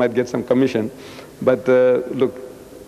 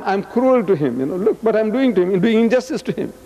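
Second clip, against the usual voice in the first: ordinary talk, nothing marked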